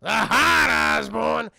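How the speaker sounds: hammed-up evil scheming; shouting goblin. Goblin telling Norman to strike the heart, hammed-up evil shout.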